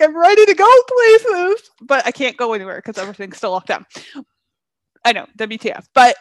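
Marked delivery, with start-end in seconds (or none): 0.0-1.7 much higher and louder, bursting with eagerness; like someone just let out of lockdown; excited. Retold or imitated. Half vaccinated and itching to go out, excited. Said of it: "I'm like".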